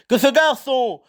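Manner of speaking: roaring giant, pitch climbing high, slow. Acted. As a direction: roar it in outrage. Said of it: "rugit Hagrid".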